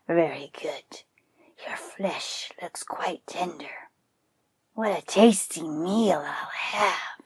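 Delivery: slow, whispered gloating predator; gloating tiger. Acted. Tiger savoring the thought of eating buffalo, slow gloating whisper. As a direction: relish the meal to come in a whisper. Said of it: "Then he laughed happily".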